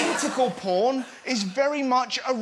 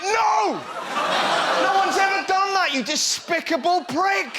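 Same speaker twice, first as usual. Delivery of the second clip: loud, high mock outrage; hammed-up indignant yell; outraged retort; 8 semitones higher, 6 dB louder than usual. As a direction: shout him down in disgust. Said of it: "And you're like".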